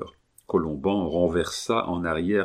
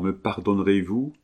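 ordinary delivery, nothing marked

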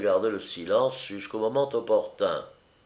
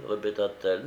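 ordinary delivery, nothing marked

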